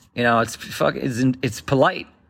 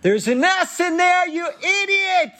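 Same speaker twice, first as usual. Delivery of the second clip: much higher shrill mom voice, yelling; mom screaming. Retold or imitated. Kidnapped mom to her son, high mock scream. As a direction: screech it like a furious mother. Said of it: "I hear my mom scream".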